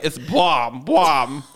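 light mock anchor on "bomb", barely shifted; a lightly voiced newscaster saying "bomb"; corrected newscaster take. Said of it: "well, it's more like".

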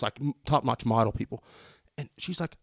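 ordinary talk

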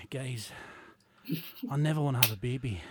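low, hushed, solemn imitation; Barry. Co-host imitating colleague's old quote to his face, hushed and grave. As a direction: drop it low and soft, with grave pauses. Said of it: "I'll do my best Barry impression".

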